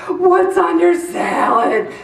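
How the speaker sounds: slow, puzzled niece voice; lightly voiced niece puzzled by sprouts; imitating niece. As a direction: drag it out like a baffled kid. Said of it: "she goes".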